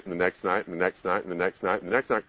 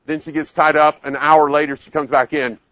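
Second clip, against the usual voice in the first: usual speaking voice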